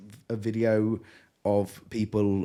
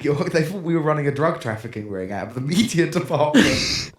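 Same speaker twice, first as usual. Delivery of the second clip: normal talking voice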